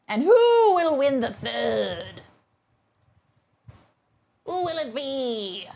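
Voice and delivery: slow, loud, booming announcer voice; dramatic announcer. Acted. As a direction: build suspense like a game-show host.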